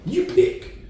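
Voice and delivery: a quick high echo of Dad; voicing Dad. Son retelling Dad handing the choice back, light high voice.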